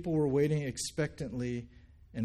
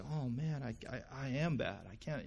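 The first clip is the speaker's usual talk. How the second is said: softer, halting self-reproach; imploding with guilt; 7 dB quieter than usual. Pretend. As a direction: crumble quietly, as if conscience-struck. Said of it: "maybe you'd implode".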